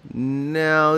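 ordinary delivery

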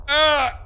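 sudden shriek of fright; high startled scream. Real, not acted. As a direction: shriek at the jump scare. Jump scare scream.

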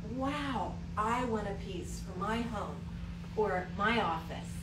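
ordinary talk, nothing marked

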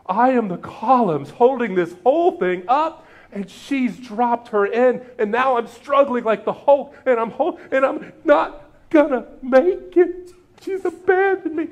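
mock Hulk collapsing under the weight; straining martyr. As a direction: groan it out like the Hulk buckling. Acting Martha as straining Hulk.

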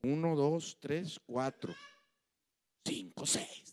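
put-on slow, fading drunk count trailing into a whisper; slurred drunk counting. Drunk husband in bed counting feet, slurred and fading. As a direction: count the feet like you can barely stay awake. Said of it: "decía yo".

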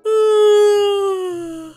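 long loud weary sigh; exasperated sigh. Real, not acted. Sighing at a question about her worst date. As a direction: sigh at all the bad dates.

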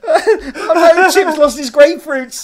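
loud high laughing. Real, not acted. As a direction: shriek with laughter. Cracking up over own line.

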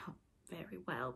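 a hushed, slightly nettled reply. A narrator's reading. Peter to Wendy, hushed and a little nettled. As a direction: let the irritation slip out quietly. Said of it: "a little nettled".

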